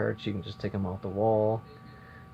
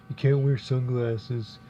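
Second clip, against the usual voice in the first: a hint of a bossy coworker, slow; 2 semitones higher, 4 dB louder than usual. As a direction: lightly mimic a know-it-all, slowly. Voicing bossy coworker Frankie.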